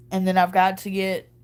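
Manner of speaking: ordinary delivery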